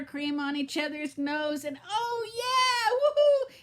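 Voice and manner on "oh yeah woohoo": high, loud hammed-up whoop; mock commercial glee. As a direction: parody the giddy ad ladies. Mocking cheerful commercial ladies.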